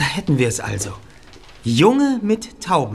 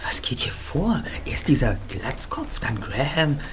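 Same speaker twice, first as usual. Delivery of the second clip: slow puzzled whisper; whisper, thinking it over; 6 dB quieter, slower than usual. Acted. Puzzling quietly in museum.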